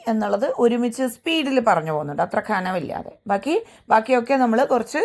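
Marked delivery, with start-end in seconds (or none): none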